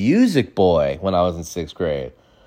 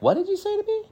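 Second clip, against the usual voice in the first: very high, softer squeak of disbelief; mock-offended falsetto; incredulous; 7 dB quieter, much faster than usual. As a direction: squeak it out, hardly believing it. Acting out incredulous reaction to a kid.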